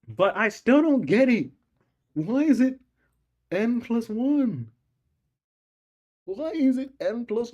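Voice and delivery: high, loud, slow frustrated whine; exasperated whine. Real, not acted. Stuck student whines at the math problem, exasperated. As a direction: drag out the complaint in despair.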